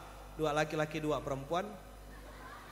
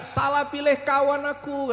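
higher, pleading cry of the drum skin; mock complaint shout; 7 semitones higher than usual. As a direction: wail it like a wronged drum. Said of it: "dia teriak-teriak".

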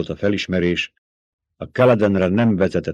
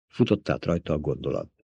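ordinary delivery, nothing marked

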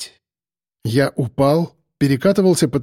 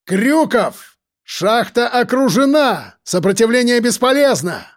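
shouting; 8 semitones higher than usual. A narrator's reading. Shouting demands into the mine.

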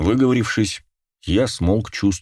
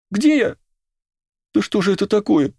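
very high, frantic questions; panicked. A narrator's reading. Girl waking in a strange place, panicked cry. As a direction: squeal in bewildered panic.

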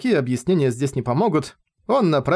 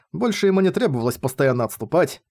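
usual speaking voice

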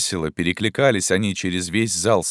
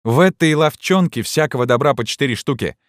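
high, raised jeer; student's shout; 7 semitones higher than usual. A narrator's reading. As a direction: call it out mockingly from the crowd. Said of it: "крикнул третий".

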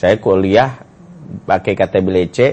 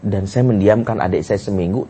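ordinary delivery

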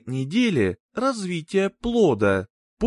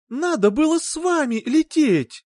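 cracking tearful heartbreak; 10 semitones higher, 4 dB louder than usual. Acted. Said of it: "Её голос дрогнул".

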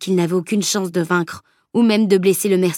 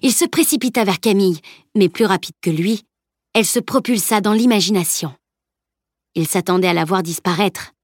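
ordinary delivery

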